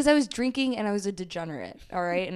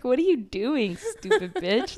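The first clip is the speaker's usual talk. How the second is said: mock insult; 5 semitones higher than usual. Mocking gym-goers.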